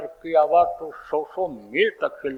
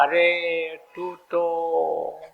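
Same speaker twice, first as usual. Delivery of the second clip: very slow, stretched-out words; drawn-out exhortation; 3 dB quieter, much slower than usual. Retold or imitated. Saints explaining to the soul where it belongs, slow drawn-out appeal. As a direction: draw out each word as a saint's appeal.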